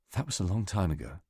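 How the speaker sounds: ordinary delivery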